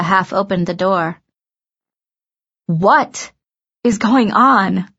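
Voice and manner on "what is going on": slow, emphatic incredulous question; baffled demand. A narrator's reading. Via at the dressing room door, baffled. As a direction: stretch it out in disbelief.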